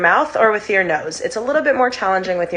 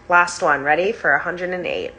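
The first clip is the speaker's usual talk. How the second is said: usual speaking voice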